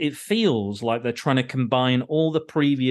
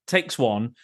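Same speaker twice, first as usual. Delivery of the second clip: normal talking voice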